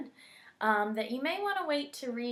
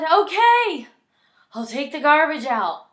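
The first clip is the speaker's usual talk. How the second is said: Sarah gives in; 6 semitones higher, 15 dB louder than usual. Acted.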